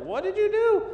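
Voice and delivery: alarmed wife. Retold or imitated.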